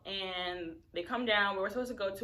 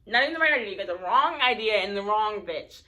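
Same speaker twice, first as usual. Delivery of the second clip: loud angry scolding; 10 dB louder than usual. Retold or imitated. Telling off the friend.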